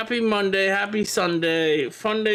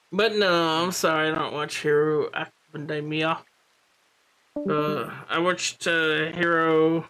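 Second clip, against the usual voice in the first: normal talking voice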